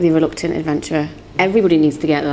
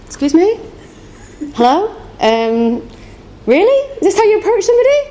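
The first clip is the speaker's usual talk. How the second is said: mock indignant; 14 semitones higher than usual. Artist re-enacting her reply to a rude request, indignant. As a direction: act out offended disbelief, pitched right up.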